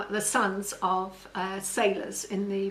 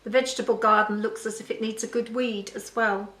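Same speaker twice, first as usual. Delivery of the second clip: ordinary delivery, nothing marked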